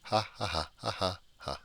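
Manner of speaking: high, soft, put-on villain laugh; mocking laugh. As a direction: laugh down at them like a smug bug. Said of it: "we're going to say".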